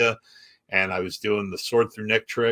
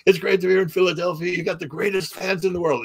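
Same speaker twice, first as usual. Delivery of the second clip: high, big stage greeting; rock star greeting; 8 semitones higher than usual. Retold or imitated. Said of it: "he'd come out and go".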